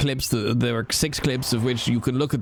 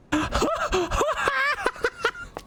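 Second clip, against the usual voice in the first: high, helpless cackle; delighted laugh. Real, not acted. Player laughing at a twofer, high cackle.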